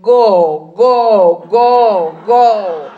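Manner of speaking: loud, droning repeated signal; talking traffic light. Shown as a demonstration. Blinking eyes as traffic lights, loud mechanical drone. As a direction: boom each "go" out like a signal. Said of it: "looks like traffic lights saying".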